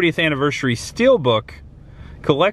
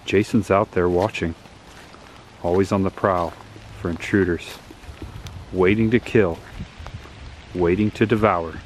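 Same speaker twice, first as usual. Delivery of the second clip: ominous narration; 4 semitones lower, 4 dB quieter than usual. Acted. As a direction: set a creepy campfire mood. Spooky horror intro narration.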